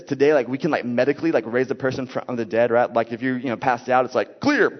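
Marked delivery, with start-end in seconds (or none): 4.4-4.7 high, punchy "clear" call; mock medic's call. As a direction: call out like a medic before a shock. Acts out a defibrillator call.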